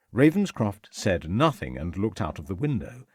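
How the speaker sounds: ordinary delivery, nothing marked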